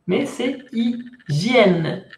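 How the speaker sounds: "hygiène" slowly, ending nasal yen. Shown as a demonstration. Teacher modeling the word for students, slow. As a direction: draw out "hygiène", nasal end. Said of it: "Aquí se pronuncia bien, yen".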